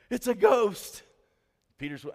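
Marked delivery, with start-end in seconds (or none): 0.1-1.0 high, loud panicked yell; freaking out. Acted. Disciples in the boat see Jesus on the water, hammed-up panicked shout. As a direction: cry out in a hammed-up fright. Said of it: "they're all freaking out".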